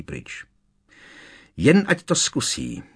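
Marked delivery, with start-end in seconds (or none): 1.6-2.8 higher, furious outburst, lightly voiced; angry shriek. A narrator's reading. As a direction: snap back in a mild shrill fury. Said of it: "vřískla Hermiona a třásla se vzteky". (vzteklé vřísknutí, lehce naznačené)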